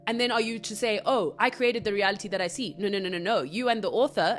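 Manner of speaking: usual speaking voice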